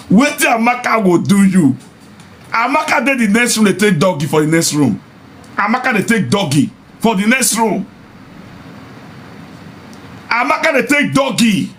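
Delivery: high-pitched, jeering warning; gleeful taunt. Real, not acted. Vlogger taunting men who marry young, gleeful. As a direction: jeer it high, relishing their downfall. Mocks husband of young girl.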